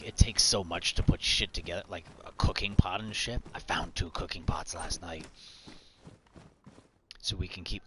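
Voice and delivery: ordinary talk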